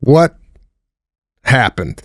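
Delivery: ordinary talk, nothing marked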